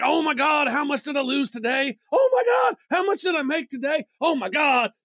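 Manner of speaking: high, frantic mock yelps of oh my God; panicked portfolio checker. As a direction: freak out over every price swing, pitch shooting up. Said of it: "I look at my block folio and I'm like".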